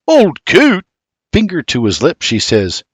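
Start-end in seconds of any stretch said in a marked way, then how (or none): 0.1-0.8 high, loud indignant echo; offended. A narrator's reading. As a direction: repeat the insult in disbelief. Husband offended by the tease.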